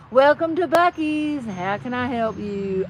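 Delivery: slow, hammed-up Tennessee drawl; Tennessee accent. Store clerk greets a customer, slow Tennessee accent. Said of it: "that is Tennessee".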